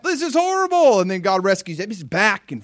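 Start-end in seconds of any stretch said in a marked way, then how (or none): 0.0-1.0 high, loud mock wail; mock crying out. Israel suffering once more, mock crying out. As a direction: cry out in exaggerated misery. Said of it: "then they cry out".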